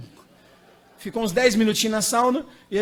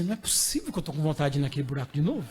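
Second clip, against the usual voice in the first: soft fast muttering to himself; 6 semitones lower, 7 dB quieter, faster than usual. Retold or imitated. Inner voice of disbelief.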